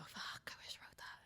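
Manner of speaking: hushed whispered envy; envious whisper. Retold or imitated. Watching a great comic's special, whispered envious awe. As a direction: breathe it out, jealous and awed. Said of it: "ones that you go".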